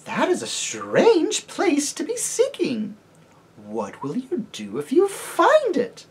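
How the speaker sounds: high, slow, sweet lady's voice; lady questioning the travelers; gracious lady. Acted. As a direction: probe them gently and sweetly. Lady character voice.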